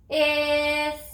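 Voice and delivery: "Is" stretched after a puff of h, ending in a hissed s; sounded out. Shown as a demonstration. Teacher touches each letter to say its sound, "Is" sounded out with final s. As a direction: draw out "Is" with an s sound, not z. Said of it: "Touch and say your sounds".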